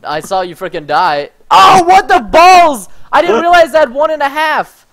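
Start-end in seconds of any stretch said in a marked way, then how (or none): 1.5-4.9 shocked yelling. Real, not acted. Yelling after dying.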